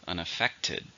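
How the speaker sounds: "Unaffected" with the main stress on its third syllable. Shown as a demonstration. Teacher answering the syllable question, "Unaffected" stressed on the third syllable. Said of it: "stressed in the third".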